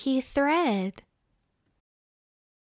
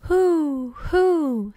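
high, louder storybook hoot; hooting owl; 4 semitones higher, 4 dB louder than usual. A narrator's reading. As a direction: draw out the owl's call on a raised pitch. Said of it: "said the owl".

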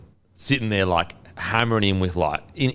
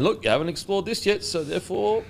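mocking; 10 semitones higher than usual. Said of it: "you just keep saying".

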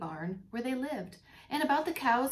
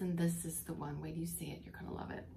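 excited whisper; 4 semitones lower, 6 dB quieter, faster than usual. Real, not acted. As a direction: whisper it like a delicious secret. Reader teases upcoming page.

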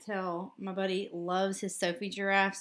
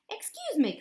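cricket voice; 10 semitones higher than usual. A narrator's reading. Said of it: "coughed a cricket".

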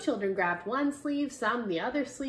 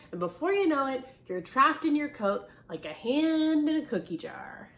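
louder, hot and bothered teacher; flustered teacher; 2 semitones higher, 6 dB louder than usual. Acted. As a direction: complain while fanning yourself. Teacher voiced, flustered complaint.

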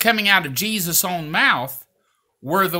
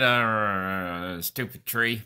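low, mock-frustrated grumble; frustrated muttering; 8 semitones lower, 5 dB quieter, much slower than usual. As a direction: grumble it under your breath, pretending irritation. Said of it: "He wasn't just frustrated".

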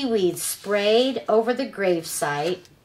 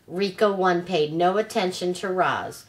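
ordinary delivery, nothing marked

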